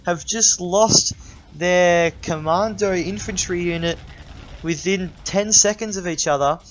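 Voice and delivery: high, loud excited casting; excited commentary. Real, not acted. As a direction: react like a shoutcaster at a big play. Shoutcaster reacting to unit deaths.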